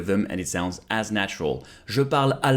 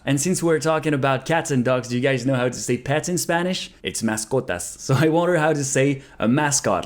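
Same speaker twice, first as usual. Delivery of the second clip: ordinary talk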